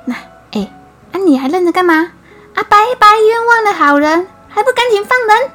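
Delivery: fast, high, loud rebuke; prefect scolding. A narrator's reading. The prefect scolds the magistrate to free the innocent man, loud and fast. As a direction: snap at him to release the man.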